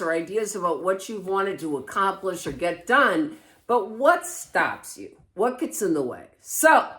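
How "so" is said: loud, higher-pitched burst; screaming. Real, not acted. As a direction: belt it out, overexcited. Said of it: "I know I'm screaming".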